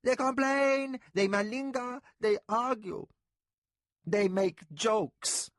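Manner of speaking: high, loud, nearly in tears with frustration; frustrated complaint. A narrator's reading. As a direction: pile up the grievances, close to tears. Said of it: "Frustrated to the point of tears".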